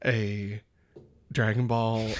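ordinary delivery

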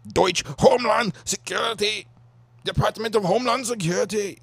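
high-pitched mock German voice; mock German accent. Comic riffing on Homeland Security, mock German accent.

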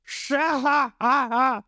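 slow, high villain's cackle; mocking laugh. A narrator's reading. Along laughing at Zorro, a slow mocking cackle. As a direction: cackle it out slowly. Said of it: "Along lacht los".

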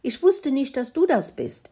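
high, loud hen retort; excitable hen, pitched well up; cackling hen. Acted. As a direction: cluck back in protest. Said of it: "gackerte Steffi drauf los".

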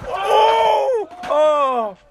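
high, loud startled yell; surprised shout. Real, not acted. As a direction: shout at the near miss. A close call on a trick.

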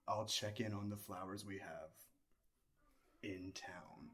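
low, slow, quiet mayor voice; Adam West. Acted. Player posing as the mayor of Quahog, low halting impression. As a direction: sink low and fade, with long pauses. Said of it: "No, I put Adam West".

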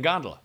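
gondola stressed on the first syllable, GON-duh-la; standard GON-duh-la. Shown as a demonstration. Telling how one grew up saying it, GON-duh-la. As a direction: hit GON and shrink the middle of gondola. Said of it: "I always grew up saying".

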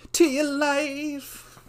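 high, loud, long-drawn exclamation; mock-dramatic cry. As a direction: belt it out hugely stretched for comic importance. Mock-grave plea to listeners.

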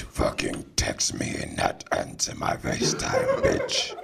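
low raspy horror whisper; Candyman. Pretend. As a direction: hiss it like a horror villain. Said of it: "Oh, you mean Candyman?".

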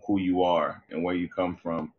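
normal talking voice

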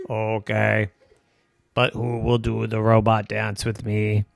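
low, even robot reply. Acted. Robot asking Evan for dance partner, monotone robot voice. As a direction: drone it out like a robot.

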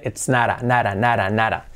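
"it's nada" said softly, fading. Shown as a demonstration. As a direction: brush the T lightly like the Spanish "nada". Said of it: "y la T bien suavecita, como un rasgue suave".

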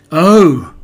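loud startled Oh; surprised cry. A narrator's reading. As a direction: blurt it out in surprise. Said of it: "cried Christopher Robin".

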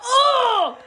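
loud, high-pitched "oh" of disgust; shocked shriek. Real, not acted. Reacting to friend calling it a fetish, shocked shriek. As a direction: shriek out an appalled "oh".

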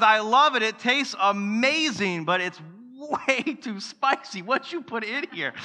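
playing his wife tasting the food; wife's voice. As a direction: lightly voice the wife's reaction. Wife's voice quoted.

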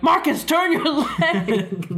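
high, slow urgent plea with a laugh; future self. Pretend. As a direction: urge him gravely like an older you. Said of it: "I see an older me".

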